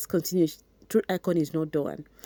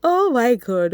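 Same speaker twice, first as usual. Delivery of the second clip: high, loud laughing outburst; 6 semitones higher, 6 dB louder than usual. Real, not acted. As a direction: burst out laughing mid-sentence. Bursts out laughing at the text.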